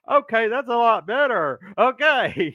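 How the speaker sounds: high, eager, delighted retort; playfully excited approval; comic relief. Retold or imitated. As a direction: brighten up high, hugely relieved. Relieved on seeing Brenda instead.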